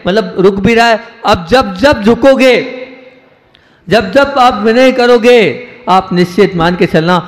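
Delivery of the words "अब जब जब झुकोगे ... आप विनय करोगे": slow, higher, each phrase weighed; measured sermon cadence, slowed right down; slow emphatic preaching. Acted. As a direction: drag it out to press the lesson home. Preacher repeats key teaching.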